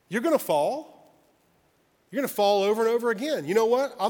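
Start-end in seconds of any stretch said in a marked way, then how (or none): none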